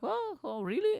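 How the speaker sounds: high-pitched mock surprise; hammed-up astonished listeners; imitated male listeners. Guys hearing about the sales, high mock surprise. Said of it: "all the guys listening to this now be like".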